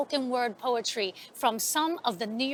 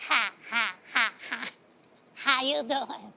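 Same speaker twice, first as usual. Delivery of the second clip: crazy Miss Stark; 4 semitones lower than usual. Acted. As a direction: play the strange old lady cackling hello.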